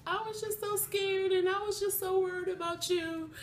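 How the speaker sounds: high, imitated tearful wail; crying. Niece phoning her aunt after the rescue, imitated crying. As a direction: pitch it way up like a niece in tears. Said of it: "she was just crying".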